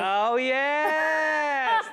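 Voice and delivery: high, loud drawn-out wail; exultant cry. Acted. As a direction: belt it out like a gospel climax. Climax of the preacher-man routine.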